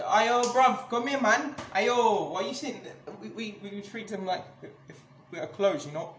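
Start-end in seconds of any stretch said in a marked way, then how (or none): 0.0-3.1 loud, higher matey call; louder mock call across the bar, friendly; calling a waiter. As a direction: yell at the waiter like a mate.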